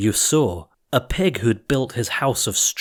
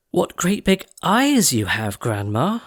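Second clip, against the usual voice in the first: put-on little girl asking; 7 semitones higher than usual. Girl questions disguised wolf.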